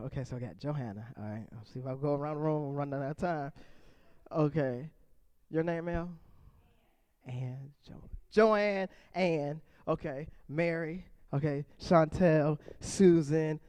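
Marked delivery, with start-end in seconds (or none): none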